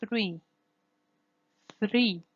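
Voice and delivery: "three" with the R tapped after th; short "three" with a flicked R; flap R. Shown as a demonstration. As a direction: tap the R once in "three". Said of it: "flap R occurs if after".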